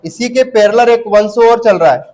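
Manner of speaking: normal talking voice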